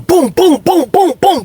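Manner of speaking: high, punchy run of booms; imitated punches.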